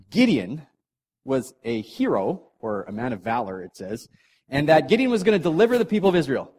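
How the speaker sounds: ordinary talk, nothing marked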